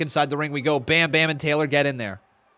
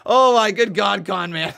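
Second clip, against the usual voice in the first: laughing; 5 semitones higher, 5 dB louder than usual. Real, not acted. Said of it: "That was funny".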